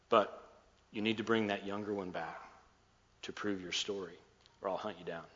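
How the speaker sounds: softly, with held-back emotion; retold holding back tears. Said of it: "Joseph holds back tears".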